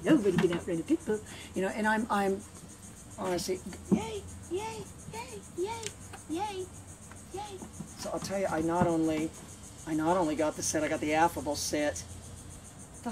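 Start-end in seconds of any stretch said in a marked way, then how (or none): 3.9-7.4 high, quiet squeals of praise; cheering. Real, not acted. Trainer praising the dog, high excited squeals.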